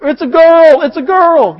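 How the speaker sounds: high, loud happy shout; excited announcing. Retold or imitated. New father on the phone, excited shout. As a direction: blurt out the news with joy. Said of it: "You just".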